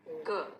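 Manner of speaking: short ग, just the sound; phonics g sound. Shown as a demonstration. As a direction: clip ग with no vowel. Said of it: "उसके बाद है". (छोटा सा ग साउंड)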